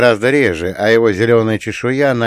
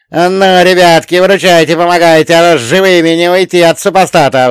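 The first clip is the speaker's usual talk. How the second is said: high, loud plea for help; loud pleading; 7 semitones higher, 9 dB louder than usual. A narrator's reading. Coachman begging his companions to save them, loud pleading. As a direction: beg everyone urgently at full volume. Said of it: "взмолился возница".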